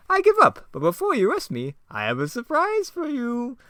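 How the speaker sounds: high, loud sly villain; Joker voice. Acted. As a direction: tease a hidden trap. Joker's trick.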